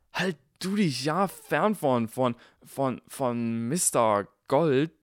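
loud, slow stuttering anger; heated stammer. A narrator's reading. Ron confronts Draco, heated and stammering. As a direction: step forward and threaten. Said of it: "sagte Ron hitzig".

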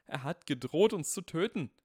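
loud, high panicked blurt. Acted. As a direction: burst out in panic. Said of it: "platzte es aus dem anderen Slytherin heraus".

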